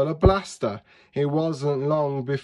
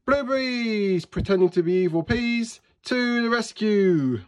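high, squeaky chorus-style battle cry; little cartoon heroes charging in; heroic team cry; 8 semitones higher than usual. A narrator's reading. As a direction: proclaim it high, stretching the last word. Blueberries make a heroic team cry.

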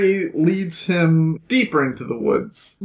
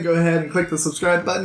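ordinary talk

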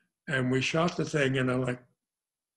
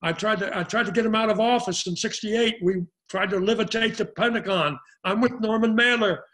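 higher-pitched, worked-up refusal; indignant retold protest; 6 semitones higher, 4 dB louder than usual. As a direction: act out arguing your way out, building in heat. Retelling his heated refusal of role.

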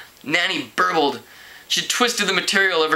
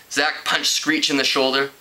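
ordinary delivery, nothing marked

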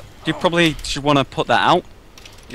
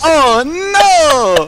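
high, loud put-on character wail; deadly cyber voice; 13 semitones higher, 7 dB louder than usual. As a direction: wail it like a hammed-up game character. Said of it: "Do deadly cyber voice".

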